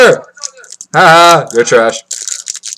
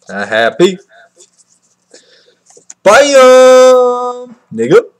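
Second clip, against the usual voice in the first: ordinary delivery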